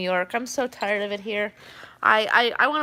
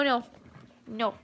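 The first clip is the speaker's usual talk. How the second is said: ordinary delivery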